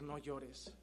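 a quiet reassuring word; gentle comfort. Acted. Jesus to the grieving widow, low and soft.

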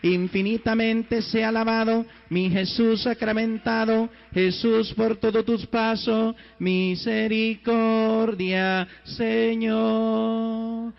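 prayer proclaimed loudly to the congregation; loud liturgical prayer. Acted. Priest blessing the congregation, loud solemn prayer. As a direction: intone the blessing with full force.

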